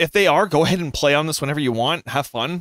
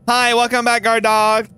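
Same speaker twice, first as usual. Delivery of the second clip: high, loud pet greeting; greeting the dog; 8 semitones higher, 4 dB louder than usual. Real, not acted. Owner welcoming back the guard dog, high and excited.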